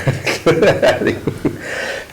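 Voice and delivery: breathy, unvoiced laughter; real laugh at being cautioned; amused laugh. Real, not acted. Presenter told not to talk too fast, amused laugh.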